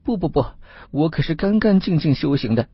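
high-pitched, anxious denial; high voice of an accused fox spirit; fox spirit protesting. A narrator's reading. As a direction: protest innocence in a high, pleading voice. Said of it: "那位黄仙说道".

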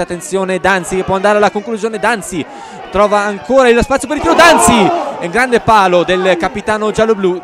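rising to a shout on Danzi; excited commentary. Real, not acted. Commentator calls a shot off post.

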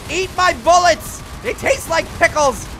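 loud, high silly taunt; goofy battle cry. Real, not acted. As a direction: taunt the enemy playfully. Trash talk while shooting.

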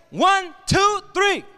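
high, loud count-in; rallying count. Real, not acted. Speaker leading students into a chant, rallying count. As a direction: count the crowd in with force.

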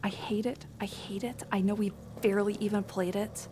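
barely voiced, whispered complaint; muttered loathing. Real, not acted. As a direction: grumble very softly, almost in a whisper. Muttered gaming frustration, under breath.

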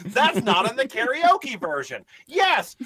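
ordinary talk, nothing marked

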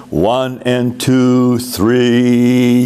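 One and two, three counted slowly and evenly; slow conducting count. Shown as a demonstration. Choir director counting slowly for singers. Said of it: "that's how we would count that as we direct".

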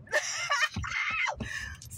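real high laugh. Real, not acted. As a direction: crack up in a high cackle. Cracks up at own retelling.

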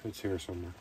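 ordinary talk, nothing marked

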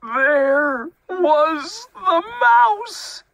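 slow, loud, high wail of discovery; horrified reveal. A narrator's reading. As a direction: stretch every word at top pitch.

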